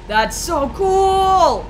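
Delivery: high, slow, drawn-out squeal of delight; wide-eyed awe; excited squeal. Real, not acted. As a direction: stretch the cool out in awe. Excited gamer reacting to game.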